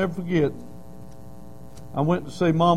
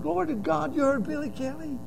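amazed; 12 semitones higher, 5 dB quieter than usual. Retold or imitated. Preacher amazed at his brother.

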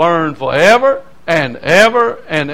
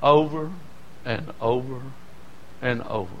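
much softer, falling to a near hush; 6 semitones lower, 13 dB quieter than usual. Acted. Preacher dropping to a hush after shouting about hell, softer. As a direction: let it drop away to almost nothing.

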